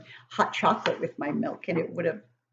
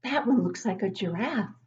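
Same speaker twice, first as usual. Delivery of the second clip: put-on childlike wonder; 5 semitones higher than usual. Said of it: "I remember as a child looking down and seeing".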